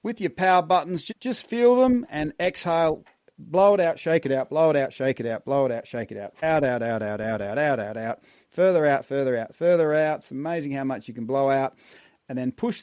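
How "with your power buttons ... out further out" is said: urgent, louder breath coaching cues; energized breathwork coaching. Shown as a demonstration. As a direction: drive the exhale with rhythmic calls. Guided breathwork exhale cues.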